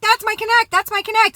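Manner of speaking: mock high-pitched insisting; imitating panicked woman. Woman vouching for her connect, imitated high-pitched.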